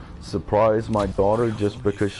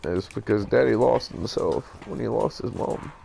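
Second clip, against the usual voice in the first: higher, halting, hurt voice; grieving; 4 semitones higher than usual. Real, not acted. Grieving father addresses his estranged children.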